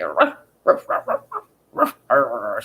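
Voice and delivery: low imitated dog woofs; mock dog barks. Owner imitating her dog asking to go potty with low woofs. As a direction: bark it like the dog does, half-joking. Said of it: "She'll be like".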